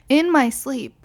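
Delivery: ordinary talk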